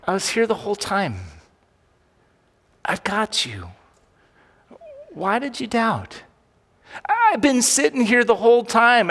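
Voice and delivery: calm a frightened friend who doubted; Jesus speaking high and gentle to his scared disciples. Acted. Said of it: "Jesus turns to them and he says".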